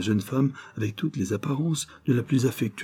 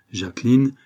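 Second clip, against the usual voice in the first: ordinary delivery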